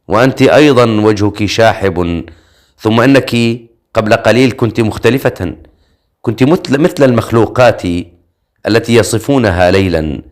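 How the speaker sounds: usual speaking voice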